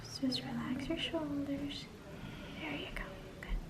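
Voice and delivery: soft, reassuring and hushed; calm the patient gently; soothing. Real, not acted. Injector calming nervous patient.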